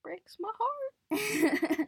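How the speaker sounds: high, slow fake sob; mock crying. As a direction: wail it like a fake cry. Catchphrase repeated as mock sob.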